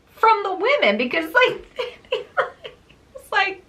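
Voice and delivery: high, laughing through the words. Real, not acted. Retelling dad sneaking away, real laughing. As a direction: giggle as the words come out. Laughing at dad's escape story.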